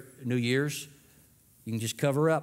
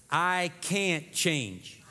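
leading a recitation; 3 semitones higher, much slower than usual. Shown as a demonstration. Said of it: "say this out loud with me".

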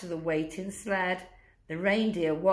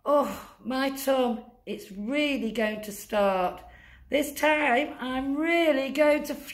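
1 panicking Santa; 6 semitones higher than usual. Acted. Santa voiced in story.

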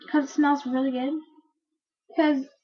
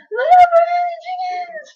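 high, loud mocking cry; mocking shout; 8 dB louder than usual. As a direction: yell it out pointing and laughing. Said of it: "telling everybody around us".

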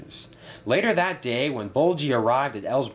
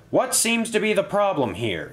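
high-pitched, mildly raised voice; loud question; 10 semitones higher than usual. A narrator's reading. Controller intervenes among cross Diesels, asking loudly. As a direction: project the question over a cross crowd. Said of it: "he asked loudly".

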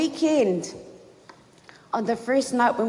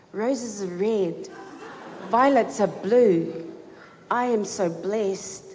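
reading a poem; 2 semitones lower, slower than usual. Retold or imitated. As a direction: savor each line slowly.

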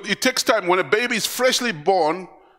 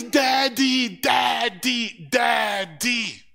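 put-on baby calling daddy; 5 semitones higher, much slower than usual. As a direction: repeat it like an eager toddler.

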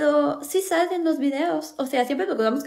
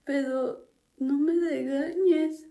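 slow, whimpering sob; fake crying; 5 dB quieter, much slower than usual. Little sister fake-crying to escape the scolding, whimpering. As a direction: fake tears to win the fight.

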